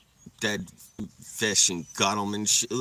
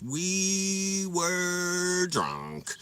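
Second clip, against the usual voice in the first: very slow, higher, stretched out; drawled drunk emphasis; 6 semitones higher, much slower than usual. Shown as a demonstration. Repeating to viewers how drunk, stretched and higher. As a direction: stretch each word like a drunk. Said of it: "we were drunk, guys".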